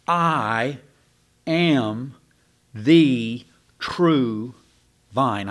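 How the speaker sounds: every word set apart, "I" and "am" punched; word by word, weight on "I", "am", "the true"; emphatic. Shown as a demonstration. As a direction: hammer out "I am the true vine" slowly, with pauses. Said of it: "I'm just saying it exactly, literally".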